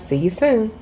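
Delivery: ordinary talk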